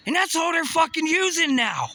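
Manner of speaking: outraged yell. Real, not acted. Ranting to viewers about banned AI, outraged yell. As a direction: blurt it out in disbelief.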